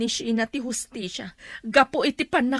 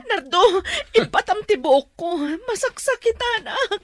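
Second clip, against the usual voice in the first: louder, higher cry of pain; 6 semitones higher, 5 dB louder than usual. Acted. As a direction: yelp at Nardo to let go. Mother pained, hair pulled.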